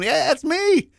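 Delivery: happy recognition, up high; delighted high I. Retold or imitated. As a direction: gleefully claim it as yours. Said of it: "you're sitting there smiling, going".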